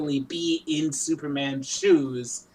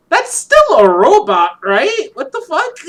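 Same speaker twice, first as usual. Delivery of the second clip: loud, rising disbelief; incredulous; 11 semitones higher, 9 dB louder than usual. Retold or imitated. Reacting to a robot sacrificing himself, incredulous. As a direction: protest it, voice climbing high.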